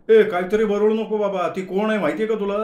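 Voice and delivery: loud, high, fast alarm; alarmed protest. Acted. Manya warns Gaju off Shubha, loud and fast. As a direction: cut him off in panic.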